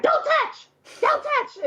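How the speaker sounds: high-pitched mock cry; retold frantic warning. Mother in labour warning her husband, frantic. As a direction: re-enact a panicked order, voice jumping way up.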